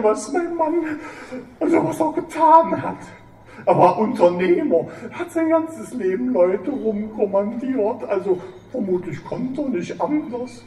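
high, sobbing and pleading. Acted. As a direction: plead through sobs. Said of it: "Frau Kamps schluchzte lauter".

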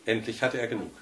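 plain reading voice

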